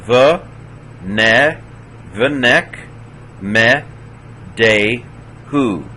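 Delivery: "the, neck, me, de, hu" sounded syllable by syllable; vocal schwa. Shown as a demonstration. Teacher showing two shvas in a row, syllables separated. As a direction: close "neck", then voice a short "me". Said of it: "second one is a vocal schwa".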